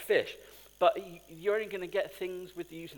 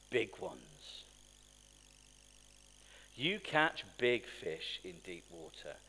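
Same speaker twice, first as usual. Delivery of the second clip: ordinary delivery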